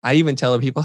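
ordinary delivery

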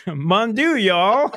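high, laughing take on a bad French accent; French exclamation in a joking Southern-French accent; mock French accent. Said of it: "some of that French accent".

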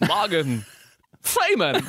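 high, halting, odd double impression; Christopher Walken as Morgan Freeman. Asked for Walken pretending to be Freeman, halting high-pitched impression. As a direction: split the name and leap up on the second word. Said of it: "now do Christopher Walken pretending to be Morgan Freeman".

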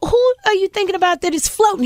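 high-pitched stammering alarm; acted-out shocked interrogation; alarmed questioning. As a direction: ask like a scandalized mother. Mother questioning son, retold.

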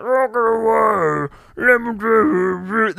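mocking rock singer. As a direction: ham up a rock singer drenched in effects. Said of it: "That's what it sounds like".

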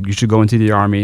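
ordinary talk, nothing marked

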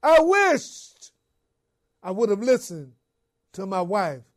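Pilate's anguished cry of regret. Retold or imitated. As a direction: cry it out, then let it fall away. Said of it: "cried out".

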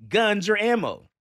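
high, loud, word-by-word declamation; exaggerated emphatic read, ANY pushed way up; all-caps reading. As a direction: blare it out as if in capitals. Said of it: "in all caps".

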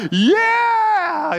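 high, loud imitated whoop; retold victory yell. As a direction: relive the home-run yell. Retelling his home-run cheer.

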